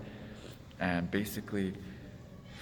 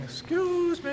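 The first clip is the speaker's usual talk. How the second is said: very high falsetto mimicry; mock the roommate in a squeaky high voice; roommate impression; slower than usual. Interrupting the teller as he wakes up, mocking falsetto. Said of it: "all of a sudden he's up".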